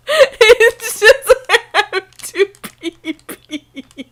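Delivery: high, slow, loud words through helpless laughter; laughing hard. Real, not acted. Explaining his own silly note, laughing helplessly. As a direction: squeeze the words out while cracking up.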